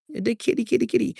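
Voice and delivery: high coaxing kitty call; calling a cat. Real, not acted. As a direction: coo for the cat to come.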